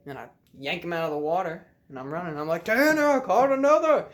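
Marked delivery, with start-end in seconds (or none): 2.7-4.1 high, loud triumphant call; excited holler. Retold or imitated. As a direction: re-enact yelling news to a distant friend. Calling friend about second catch.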